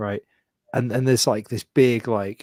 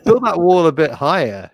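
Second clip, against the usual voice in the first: loud mock urgent; 7 semitones higher, 9 dB louder than usual. Joking suggestion.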